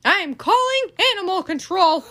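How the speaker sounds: loud, high, decisive declaration; bossy Eugenia. Acted. Eugenia telling Baby she's calling animal control, loud and decisive. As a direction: announce it as final.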